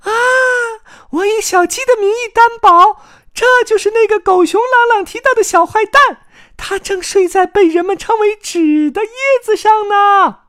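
very high, loud chick squeal; excited shouting. Acted. Carmen at the window tells the chicks, excited shout. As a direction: proclaim the find in a high loud voice. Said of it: "卡门兴奋地喊道".

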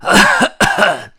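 loud theatrical throat-clear. Acted. Story grandpa readies himself to begin, loud throat clearing. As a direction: clear the throat before a big announcement. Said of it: "清了清嗓子".

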